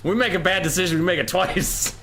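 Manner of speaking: high, emphatic mock-patriotic declaration; mock defiance. Caster defending a failed bit, mock defiance.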